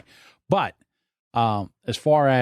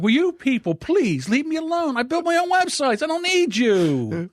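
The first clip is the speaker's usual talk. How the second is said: fed-up outburst. Retold or imitated. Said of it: "I lost my mind".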